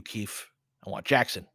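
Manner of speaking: ordinary talk, nothing marked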